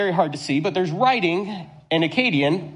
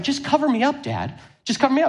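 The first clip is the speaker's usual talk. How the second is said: mock angry child; 7 semitones higher, faster than usual. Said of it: "He's getting angry".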